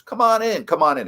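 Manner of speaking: high, loud, cheery come-in; a bluff old manager waving a kid inside; hearty welcome. Retold or imitated. Sparky inviting the young reporter into his office, hearty and loud.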